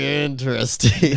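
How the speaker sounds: slow, drawn-out mock "Interesting"; imitating alien "interesting". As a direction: copy his alien way of saying it. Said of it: "The way you say interesting makes you sound like an alien".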